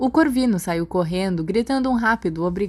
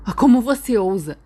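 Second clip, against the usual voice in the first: louder, higher indignant outburst; fuming outrage; 4 semitones higher, 6 dB louder than usual. A narrator's reading. Regulus outraged at Remus, a loud indignant outburst. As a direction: spit out the outrage. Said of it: "Regulus ferveu".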